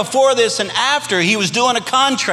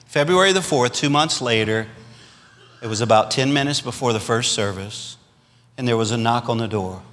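normal talking voice

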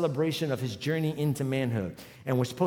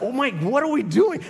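panicked; 8 semitones higher, 6 dB louder than usual. Retold or imitated. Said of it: "I was like".

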